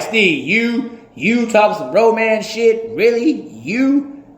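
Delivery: high, loud teasing disbelief; mock incredulous. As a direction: tease Steve like a skeptical buddy. Friends mocking his romantic side.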